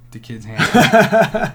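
slow, loud laughing punchline. Real, not acted. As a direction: laugh through the words.

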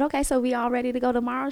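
high, fast chirpy mom check-in; mom's voice. Retold or imitated. Mom asking daughter if she is ready, high and quick. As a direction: rattle it off brightly. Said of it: "I said".

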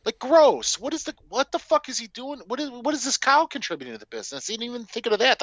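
high-pitched baffled ranting; incredulous disgust. Real, not acted. Podcaster ranting to co-host about the cow's role, high incredulous disgust. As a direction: pitch way up, firing off appalled questions.

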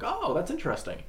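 a customer humouring him; polite mock interest. Coworkers' polite reaction.

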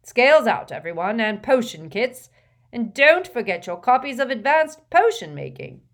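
jovial professor. A narrator's reading. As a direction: boom out instructions cheerfully. Slughorn's voice.

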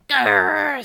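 loud, high snarl; witch's angry growl. A narrator's reading. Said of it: "said Baba Yaga as she jumped".

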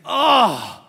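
high, loud groan; a sharp oh of letdown; dismayed oh. Retold or imitated. Pastor reacting to a touchdown, dismayed. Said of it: "And I was just like".

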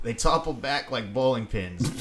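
ordinary talk, nothing marked

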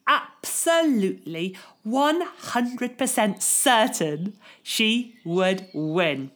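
ordinary delivery, nothing marked

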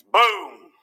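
sudden loud "boom"; great noise. Retold or imitated. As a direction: burst out like an explosion. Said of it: "with a great noise".

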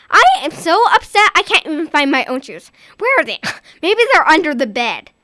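loud, exasperated, wry laugh; fed up hunting for shoes; frustrated. Acted. Mother frustrated searching shoes.

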